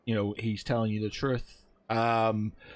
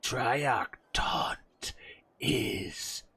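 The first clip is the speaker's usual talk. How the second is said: raspy dying whisper; 4 dB quieter, much slower than usual. Acted. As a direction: breathe it out, barely voiced.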